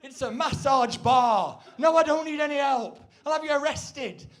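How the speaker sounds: haughty customer. Retold or imitated. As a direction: put the clerk in their place. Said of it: "And you go".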